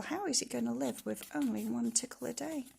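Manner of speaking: plain reading voice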